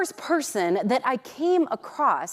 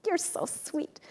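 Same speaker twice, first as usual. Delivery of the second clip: sweetly dismissive; 6 semitones higher, 9 dB quieter than usual. Retold or imitated. Retold brush-off of compliment.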